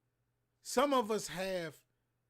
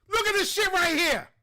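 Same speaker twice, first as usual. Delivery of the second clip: sudden furious yell, much higher and louder; outraged shout; 13 semitones higher, 10 dB louder than usual. Real, not acted. Reacting to the killing video, a furious shout. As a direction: explode at the footage in real anger.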